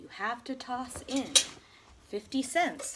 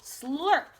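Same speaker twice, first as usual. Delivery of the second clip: loud slurp; 5 semitones higher, 6 dB louder than usual. Acted. Sound effect in the story.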